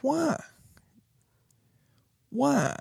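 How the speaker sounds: high, pleading why; exasperated why. Retold or imitated. Father retelling his reaction to the boy, high exasperated why. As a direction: ask why in disbelief. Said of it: "And I was like".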